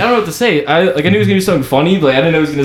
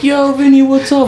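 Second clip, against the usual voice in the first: high-pitched, hammed-up hello; fake excited greeting; 10 semitones higher than usual. Storyteller playing along with friend's fake reunion, hammed-up excitement. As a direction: play along with a staged reunion.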